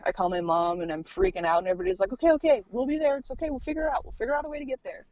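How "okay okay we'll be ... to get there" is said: mock-flustered comforting; hurried reassurance. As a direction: imitate relatives calming someone in a rush. Family reassuring her on phone.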